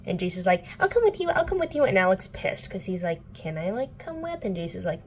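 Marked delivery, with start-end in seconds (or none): 0.8-1.8 mock-eager offer, pitched much higher; hammed-up keen boy, insisting; eager Jace. 3.3-4.4 small, softer plea rising high at the end; mock meek hopeful ask; timid Alec.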